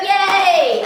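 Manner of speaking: high, loud yay; excited cheer. Real, not acted. Unboxer thrilled at getting a t-shirt, excited cheer.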